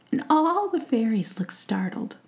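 usual speaking voice

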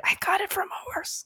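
very soft, high whispered tagline; triumphant whisper. Shown as a demonstration. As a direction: lean in and breathe it out. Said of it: "Lean in and whisper it".

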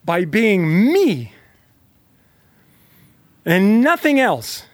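very slow, rising on "and nothing else"; slow affirmation. Shown as a demonstration. Coach leading a listener's self-affirmation, slow and emphatic. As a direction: say it slowly, like a vow you mean. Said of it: "I want you to say to yourself right now".

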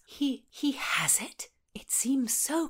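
hushed, slow amazed question; whispered. A narrator's reading. Constance whispers in disbelief about the pomander. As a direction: breathe it in wonder.

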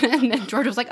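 usual speaking voice

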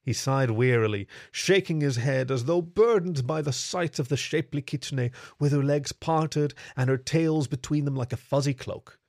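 ordinary delivery, nothing marked

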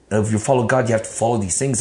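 ordinary delivery

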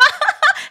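very high, loud cackling laugh; helpless high laughter; loud burst of laughter. Real, not acted. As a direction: let out a big squealing laugh. Cracks up at own question.

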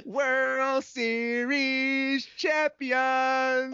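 long, drawn-out high victory shout; triumphant shout. Acted. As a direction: bellow it like a stadium announcer. Celebrating Cleveland joke.

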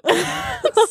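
very high laughing burst. Real, not acted. Laughing at the memory.

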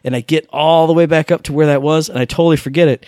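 normal talking voice

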